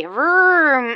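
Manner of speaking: playful vroom engine noise; airplane vroom. Acted. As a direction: make the spoon fly.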